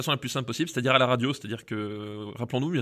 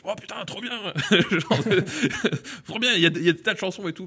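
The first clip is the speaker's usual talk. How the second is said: high, laughing excited outburst; excited teen gushing; 8 semitones higher, 3 dB louder, faster than usual. Retold or imitated. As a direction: gush like a thrilled thirteen-year-old. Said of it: "je fais". (ado surexcité qui rit)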